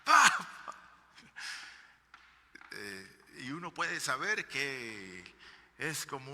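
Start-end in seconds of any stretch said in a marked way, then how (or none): 0.0-0.5 high, loud sudden cry of "Papá"; retold scream of grief; daughter's cry. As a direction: cry out for her father in shock. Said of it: "ella pega un grito".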